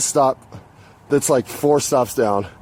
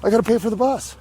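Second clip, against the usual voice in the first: high, incredulous pushback; confused and defensive, voice up high; mock bewildered reply to a stranger; baffled protest; 9 semitones higher, faster than usual. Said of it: "I'm like".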